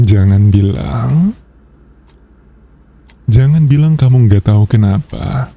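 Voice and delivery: normal talking voice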